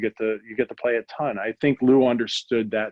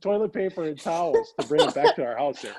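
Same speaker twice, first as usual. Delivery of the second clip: laughing; 10 semitones higher than usual. Real, not acted. Retelling the roommate's absurd request.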